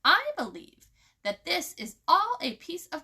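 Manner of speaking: loud, high, furious retort; angry outburst. A narrator's reading. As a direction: lose her temper. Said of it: "She lost her temper".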